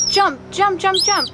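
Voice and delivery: excited coaxing of the pet; eager urging. Real, not acted. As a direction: cheer the pet to jump in a high voice.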